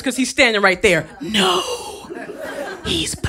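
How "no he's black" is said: higher, slow, hushed confiding whisper; whispering receptionist. Retold or imitated. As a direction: lean in and whisper the secret. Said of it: "And she whispers".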